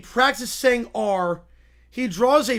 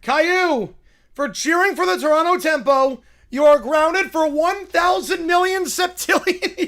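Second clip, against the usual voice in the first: stern scolding; 5 semitones higher, 5 dB louder than usual. Acted. Boris scolding Caillou.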